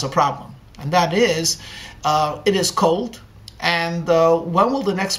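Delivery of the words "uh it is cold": ordinary delivery, nothing marked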